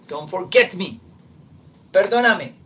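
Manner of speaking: ordinary talk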